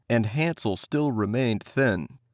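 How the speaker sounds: plain reading voice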